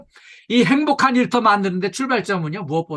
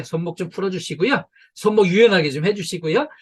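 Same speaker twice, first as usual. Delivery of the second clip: usual speaking voice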